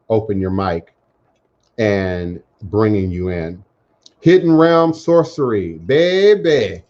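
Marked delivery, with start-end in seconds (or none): 4.2-6.8 put-on a raised, louder New Orleans accent, baby drawn out. Host imitating a guest's sign-off in a New Orleans accent. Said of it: "in that New Orleans accent".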